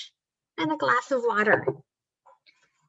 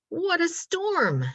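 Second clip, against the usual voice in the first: high little bird voice; twittering wren; 8 semitones higher than usual. A narrator's reading. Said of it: "twitters a wren".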